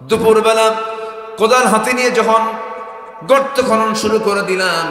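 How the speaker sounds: loud, high chanted narration; full-force preaching. Acted. Father telling of digging at noon, full-force preaching. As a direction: belt short phrases with pauses between.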